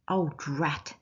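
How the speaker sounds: ordinary delivery, nothing marked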